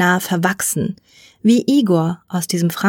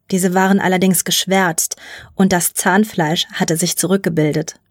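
ordinary delivery

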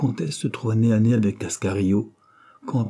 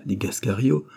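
ordinary delivery

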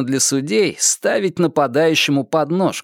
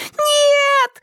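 пронзительный девичий визг, очень высоко; завизжать в испуге; 3 dB louder than usual. A narrator's reading. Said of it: "завизжали девчонки". (визгливо, громко)

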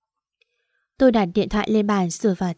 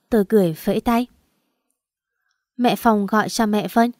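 plain reading voice

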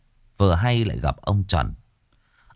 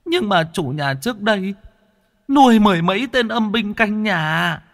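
high, thin, cowering ghost voice, stuttering; a frightened spirit begging the master; stammering ghost; 13 semitones higher than usual. Acted. Ghost answering the exorcist, high and stammering in fear. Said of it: "Nó lắp bắp".

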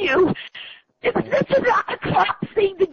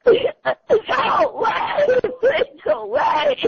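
crying plea. Real, not acted. Woman crying out for her friends to hurry. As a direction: beg for help through tears. Frightened victim calls for help.

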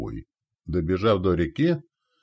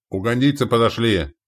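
raised, high announcement; shout; 4 semitones higher, 4 dB louder than usual. Acted. Commander telling men allies have arrived, shouting. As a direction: call out the good news. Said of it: "крикнул Лонни".

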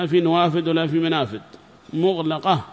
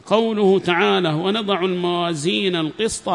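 higher-pitched formal recitation of a book; formal lesson reading voice; reading a text aloud; 4 semitones higher, 4 dB louder than usual. Acted. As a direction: read the passage out clearly to a class.

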